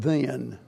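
the word "then" said low and falling; stressed then. Shown as a demonstration. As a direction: lean on "then" as the key word. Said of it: "underline the word".

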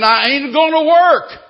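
very loud, high defiant shout. Acted. Slave shouting over the rising bids, defiant. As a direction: shout the refusal over the bidding. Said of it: "all the time him saying".

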